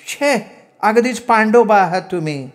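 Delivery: high woman's scolding; exasperated sweetheart. A narrator's reading. Woman calling her admirer a bore, high exasperation. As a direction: dismiss him in a high put-on voice.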